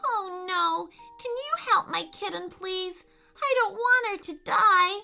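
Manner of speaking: put-on high, desperate child's plea; acted distressed little girl pleading; pained cry. A narrator's reading. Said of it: "cried out with a painful voice".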